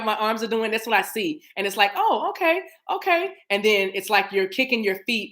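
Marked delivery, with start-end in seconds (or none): 2.0-3.3 high-pitched little "oh, okay"; mild surprised realization. Acted. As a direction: react lightly, high and tentative. Voices floating in a pool.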